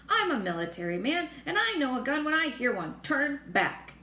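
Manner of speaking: insistent shout. Acted. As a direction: order the retreat at full voice. General insists they turn back.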